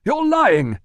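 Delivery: loud, very high yell. Acted. Manager yells at his doorman to shut up, loud and high. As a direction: bark him silent.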